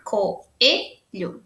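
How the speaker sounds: "Coelho" in three short parts with pauses; separated syllables. Shown as a demonstration. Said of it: "é só pra gente separar".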